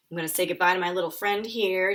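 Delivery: plain reading voice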